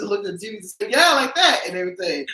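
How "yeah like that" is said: high, loud vindicated shout; emphatic agreement. Retold or imitated. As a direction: point and say it triumphantly. Parents pointing at the TV.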